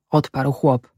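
plain reading voice

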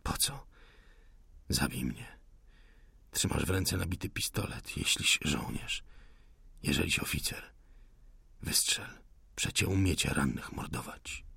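wounded insurgent whispering contempt; weak, whispered defiance. Acted. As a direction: dare him to shoot, barely audible. Wounded man defies the officer.

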